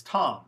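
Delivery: "tom" with an open, stressed ah; full vowel. Shown as a demonstration. Teacher contrasting it with atom, full-vowel "tom". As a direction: say "tom" fully, no schwa. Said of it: "But this word is".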